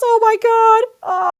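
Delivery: high wailing cry of guilt; anguished wail. Acted. Player's character howls over her slaughtered family.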